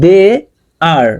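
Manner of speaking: "They are" with a pause between the words. Shown as a demonstration. Teacher showing separate words, "They are" split apart. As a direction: give "They" and "are" each its own beat. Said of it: "আলাদা আলাদা word".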